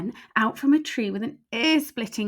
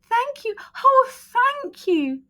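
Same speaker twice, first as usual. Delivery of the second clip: high, slow heartfelt thanks; grateful; 12 semitones higher, 4 dB louder than usual. A narrator's reading. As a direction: gush with gratitude. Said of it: "the grateful witch cried".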